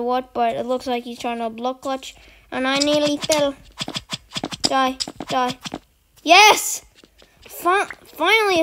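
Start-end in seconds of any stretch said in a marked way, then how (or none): 6.2-8.6 a sudden high, loud cheer of triumph; excited yell. Real, not acted. Gamer yelling as the opponent finally dies, excited cheer. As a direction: shout it out when the kill finally lands.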